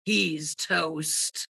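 low, soft muttered aside. Acted. Bankrupt cousin muttering at the table, low and soft. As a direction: mutter it under your breath, deep. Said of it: "muttered Mister Darby".

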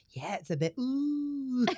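high, slow teasing mimicry; imitating co-host. Host mimics co-host's vague adjective, teasing imitation. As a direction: echo your co-host with a drawn-out ooh. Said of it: "this is gonna repeat that Robin went".

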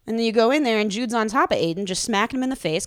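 ordinary talk, nothing marked

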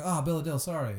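apologetic. Pretend. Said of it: "I go".

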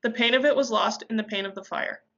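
usual speaking voice

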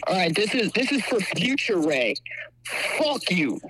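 tinny speakerphone voice, slow; over the phone line; phone speaker. Real, not acted. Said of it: "you're on speaker".

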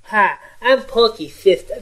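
Porky's sister voice. Retold or imitated. Cohost imitating the girl to show what scares him. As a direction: creep him out with a sweet child greeting. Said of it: "come up and go".

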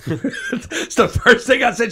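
laughing, high and loud; words breaking into real laughter; real laughter while telling it. Real, not acted. Laughing at own story.

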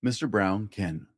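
plain reading voice

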